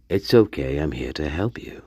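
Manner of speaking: low, hushed device voice; low put-on assistant voice; mock Alexa. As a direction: drop low and soft, as a creepy fake assistant. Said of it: "it probably was the Alexa".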